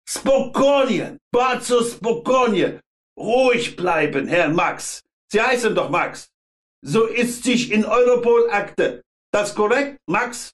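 put-on high, hard Polish-accented inspector. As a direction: bark it in rough Polish-accented German. Polish inspector calms Max.

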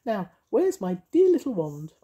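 high, sing-song witchy voice; bright, bossy little witch. Acted. Mavis voiced in story.

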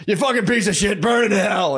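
high, mock furious shout; imitated angry outburst. Man erupts at the sentenced guy, imitated shouting. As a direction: erupt in a retold courtroom yell. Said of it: "some guy just erupts".

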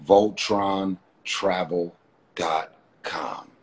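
slow, deliberate brand read; slow and spelled out. Shown as a demonstration. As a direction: lay out every syllable so they can type it. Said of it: "That's how you spell it".

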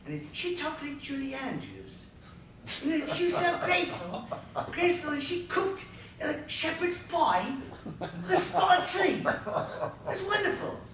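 high-pitched, hammed-up British lady voice; mock British accent. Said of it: "went into my British accent".

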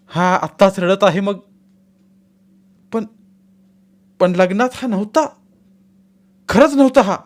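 frightened bewilderment. A narrator's reading. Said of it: "तिच्या अंगात कंप भरला".

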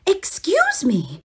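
ordinary delivery

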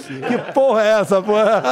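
laughing loudly in disbelief. Real, not acted. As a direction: blurt it out through laughter. Laughs at the song title.

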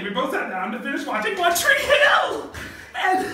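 high, loud laughing shout; excited shout. Real, not acted. Teen girl telling friends, shouting with glee. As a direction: burst out with delight.

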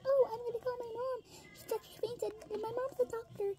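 high, soft put-on voice rehearsing a line; squeaky-high pretend voice, kept small; acted-out excuse. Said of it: "I could just say".

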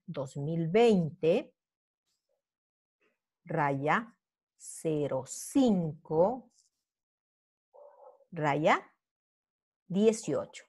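slow, word by word, with long pauses; slow dictation. Shown as a demonstration. Teacher dictating the date to the class, slow and spaced. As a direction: spell it out slowly so students can write.

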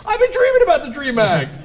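high, loud hammed-up boasting; mock bragging. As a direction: brag it like a shameless turncoat. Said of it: "they're gonna brag about this".

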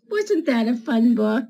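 plain reading voice

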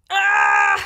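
loud strained cry of effort. Real, not acted. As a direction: cry out while straining to open it. Struggling to open a product.

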